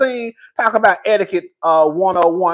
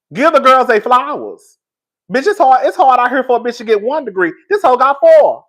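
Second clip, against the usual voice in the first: dramatic emphasis; 5 semitones higher, 5 dB louder than usual. Real, not acted. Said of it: "dramatic in my delivery".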